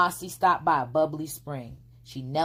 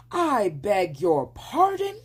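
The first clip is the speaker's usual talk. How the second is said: gasped; 5 semitones higher, 4 dB louder, slower than usual. A narrator's reading. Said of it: "he gasped".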